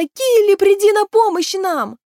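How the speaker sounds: high, loud plea for help; desperate summons. A narrator's reading. Maeve summoning the river horse to help, high shout. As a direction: call her urgently to the rescue.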